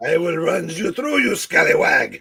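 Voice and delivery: loud, hammed-up challenge; swashbuckling threat. Heckling the host mid-scene, mock swashbuckler. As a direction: build to a loud finish like a stage duellist.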